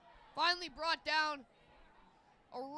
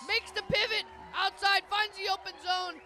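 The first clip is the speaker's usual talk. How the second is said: excited commentary; 4 semitones higher, 6 dB louder than usual. Real, not acted. Excited co-commentator after touchdown.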